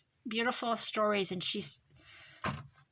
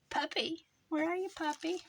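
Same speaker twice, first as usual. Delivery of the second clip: higher sing-song call for the puppy; 8 semitones higher than usual. Real, not acted. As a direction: coax the lost puppy out, high pitched. Calling for a lost puppy card.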